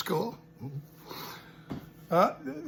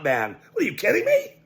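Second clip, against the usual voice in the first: usual speaking voice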